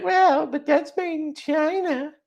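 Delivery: high, slow mocking whine; mocking rival knife maker. Mocking rival makers' comeback, in a high slow put-on voice. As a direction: mimic a sniffy competitor, drawn out. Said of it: "They're gonna be like".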